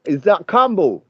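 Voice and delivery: brash London lad calling a stranger; Joaquin, London lad. Acted. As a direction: play a brash London bloke on the phone. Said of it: "so I'm Joaquin".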